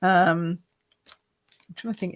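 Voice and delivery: ordinary talk, nothing marked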